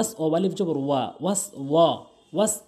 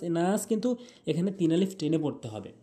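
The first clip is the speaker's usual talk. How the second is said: ordinary delivery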